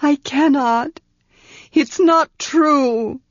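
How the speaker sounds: pleading in pain; in agony. A narrator's reading. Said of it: "Tanar said in agony".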